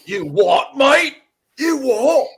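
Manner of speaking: high, loud, cartoonish British "mate" voice; mock British accent. The British actor mocked, puzzled by the Green Arrow offer, in a hammed-up British accent.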